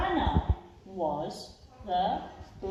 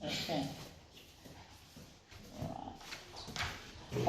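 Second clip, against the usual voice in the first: usual speaking voice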